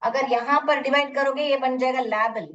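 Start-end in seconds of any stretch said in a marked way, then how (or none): 2.0-2.5 लेबल misread as LAB-ul; short "a" of "lab" in लेबल; wrong short-vowel reading. Shown as a demonstration. Teacher shows what a wrong division gives, short-vowel misreading. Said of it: "तो ये गलत होगा".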